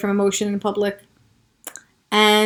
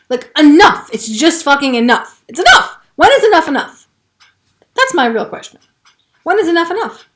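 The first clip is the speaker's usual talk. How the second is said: much higher and louder, near shouting; real exasperation; 7 semitones higher, 5 dB louder than usual. Real, not acted. Demanding when the suffering ends, real fury. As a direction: cry out that she's had enough. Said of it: "I feel emotional about it right now".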